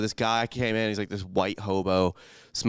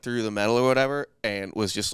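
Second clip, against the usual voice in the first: normal talking voice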